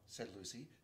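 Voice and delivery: ordinary delivery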